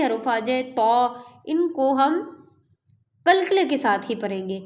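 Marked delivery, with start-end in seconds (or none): none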